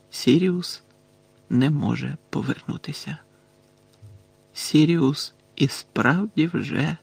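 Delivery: soft, halting, grieving narration; subdued, with heavy pauses; hushed. A narrator's reading. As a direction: say it quietly, breaking off unfinished. Narrating the realization Sirius is gone.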